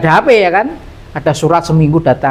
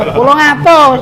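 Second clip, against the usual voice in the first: amazed; 10 semitones higher, 5 dB louder, slower than usual. Real, not acted. Marvelling at old fuel price.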